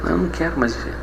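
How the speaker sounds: very soft, understated despair; retold tearful plea. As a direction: quote her crying words in a hushed voice. (choro contido, relatado bem baixinho)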